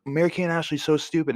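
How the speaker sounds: put-on high, quick childish put-down; bickering kid. Brother squabbling with sister over TV, mock-childish. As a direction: sneer it fast like a squabbling kid. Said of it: "And I'm like".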